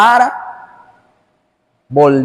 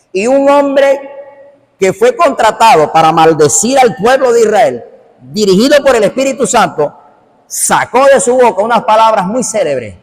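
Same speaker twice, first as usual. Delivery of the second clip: raised, higher preaching voice; prédica enérgica; 4 semitones higher than usual. Real, not acted. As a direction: build the tale with forceful, ringing emphasis. Preaches at full force. (predicación con voz alta y enérgica)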